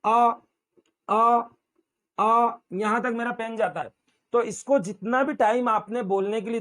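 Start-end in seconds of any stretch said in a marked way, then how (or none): 0.0-1.4 आ held out long and steady; long vowel demo. Shown as a demonstration. Teacher showing students a long vowel, drawn out. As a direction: stretch आ to twice the length. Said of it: "देख लीजिए एक बार वापस".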